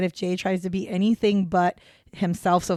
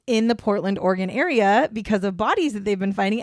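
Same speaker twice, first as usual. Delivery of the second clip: usual speaking voice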